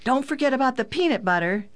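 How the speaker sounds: high, quick reminder, mildly called out; child's yell. A narrator's reading. Daughter reminding father before shopping, quick mild yell. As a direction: call it high and fast, only lightly yelled. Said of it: "Pam yelled".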